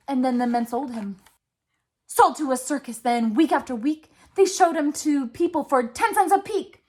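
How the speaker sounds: ordinary delivery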